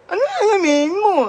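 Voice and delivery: high slurred questioning, lightly acted; puzzled mother in a put-on Ngongo voice; mock Ngongo mother. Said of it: "yung kwento ni Ngongo".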